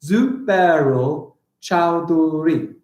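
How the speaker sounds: slow, broken-up "Zubairul Chowdhury"; deliberate, stretched syllables. Shown as a demonstration. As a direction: count out every beat of "Zubairul Chowdhury". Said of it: "that's only six altogether".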